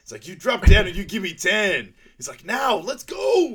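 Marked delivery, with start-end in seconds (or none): 0.3-1.9 loud, high drill sergeant order; drill order shout. Retold or imitated. Frank ordering frail old Blue to do push-ups, mock drill shout. 2.4-3.5 slow, much higher, loud command; drill shout. Retold or imitated. Frank making old Blue start his push-ups, drawn-out yell.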